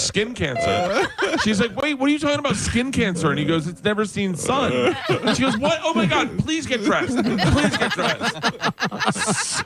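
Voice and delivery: very high, squealing through laughter; laughing mock outrage. Appalled dermatologist and naked patient re-enacted, laughing helplessly. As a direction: play the appalled exchange while losing it.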